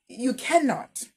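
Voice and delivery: ordinary talk, nothing marked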